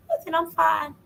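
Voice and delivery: high-pitched mock brave-through-tears; acted tearful. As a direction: pretend you're fine while crying, high voice. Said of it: "I've been crying my eyes out".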